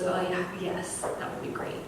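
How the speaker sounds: soft, relieved acceptance; imitating a man deflating, very softly; suddenly calmed. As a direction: drop right down to a quiet, grateful yes. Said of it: "he, he calms".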